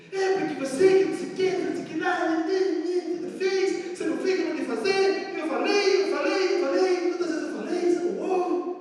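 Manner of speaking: hammed-up scolding partner; mock angry nagging. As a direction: nag and scold in a shrill-free high pitch. Acts out an angry accuser.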